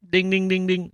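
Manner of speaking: high-pitched ringing dings; imitated sleigh bells. Host mimics the scene's sleigh bells, high sing-out dings. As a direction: chime like little bells. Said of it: "I don't have the sleigh bells".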